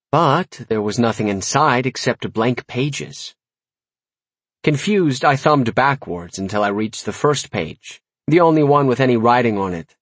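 usual speaking voice

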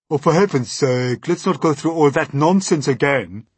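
put-on complaining character voice; exasperated earthworm. A narrator's reading. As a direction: groan at the nonsense in a worm voice. Earthworm complaining about boots.

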